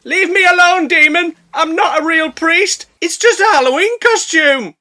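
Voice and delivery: high, loud mock-terrified shouting; hammed-up panicked plea. Old fella running away from something menacing, mock panic. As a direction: plead for your life, comically overblown. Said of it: "running away from something menacing, saying".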